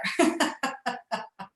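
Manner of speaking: light, trailing laughter; real laugh. Real, not acted. Speaker amused telling of surgery going better, laughing. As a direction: laugh after your own punchline. Laughs after upbeat story line.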